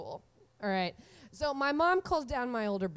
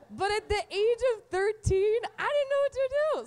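put-on pitched way up, panicky teen; mock teen panic; 9 semitones higher, 4 dB louder than usual. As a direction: play the lost thirteen-year-old.

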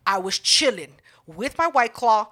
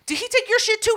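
incredulous stranger; 12 semitones higher than usual. Retold or imitated. Stranger voiced in story.

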